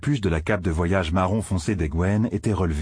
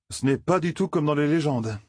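a touch of a plaintive moan, pitched up; lightly voiced moan; 8 semitones higher than usual. A narrator's reading. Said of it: "gémit Matt". (gémissement léger)

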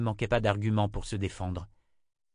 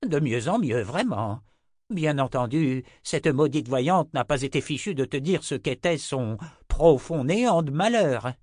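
a touch of bitter mocking laughter; grating sarcasm; 6 semitones higher, 5 dB louder than usual. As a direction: scoff with a grating edge. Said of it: "avec un rire grinçant".

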